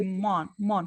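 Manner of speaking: mon, mon, the M joined to the vowel as one syllable; linked syllable. Shown as a demonstration. Teacher to student, repeating the joined syllable. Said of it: "forma uma sílaba ali".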